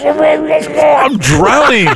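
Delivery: high, very slow strangled cry of panic; panicked drowning cry. Retold or imitated. Comedian reenacting his near drowning, high panicked wail. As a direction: wail it like you're going under.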